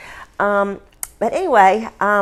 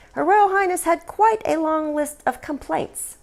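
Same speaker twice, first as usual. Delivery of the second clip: arch, higher-pitched teasing; archly; 10 semitones higher than usual. Acted. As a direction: lift it up slyly as a knowing tease. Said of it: "Diana said archly".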